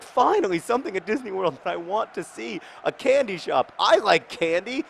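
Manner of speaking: recalling a young self excited for the candy shop, lightly hammed-up; imitating childhood excitement. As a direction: sound like a kid thrilled about candy. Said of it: "And I was like".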